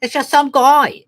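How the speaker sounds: high, drawn-out imitation of a wife; vague wife. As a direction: play the wife giving a vague answer. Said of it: "she was very vague".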